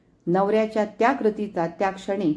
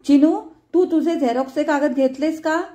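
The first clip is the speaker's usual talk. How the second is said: sharp question; 7 semitones higher, 6 dB louder than usual. A narrator's reading. Suspicious mother questions daughter.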